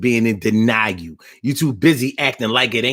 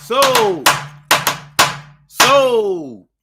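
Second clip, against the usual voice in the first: auctioneer calling sold; 14 semitones higher, 4 dB louder than usual. Pretend. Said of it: "I said".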